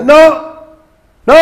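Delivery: sudden high outraged shout. Retold or imitated. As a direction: scream the protest in mock horror. Mock outraged objection to hanging.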